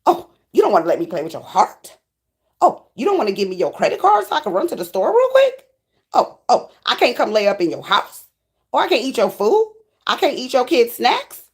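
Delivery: put-on offended disbelief, pitch leaping up; mock indignation. Rejected freeloading lover questioning the one who refuses, mock indignation. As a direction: act affronted at every refusal, questions swinging high. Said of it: "They said".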